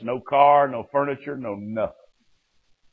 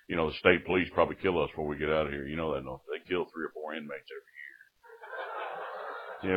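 softer, lower, quick then slowing murmur; low grim warning; 5 semitones lower, 9 dB quieter than usual. Retold or imitated. Old cellmate quietly warning the boy about police. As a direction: warn him quietly, like a jailhouse old-timer.

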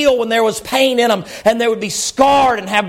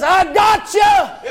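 triumphant cry; 6 semitones higher, much slower than usual. Acted. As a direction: proclaim it like a rescue. Ziba lifting Mephibosheth, climactic.

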